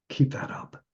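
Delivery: hushed whisper. Retold or imitated. Last words to dying friend.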